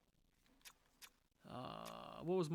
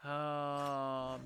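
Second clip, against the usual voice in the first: usual speaking voice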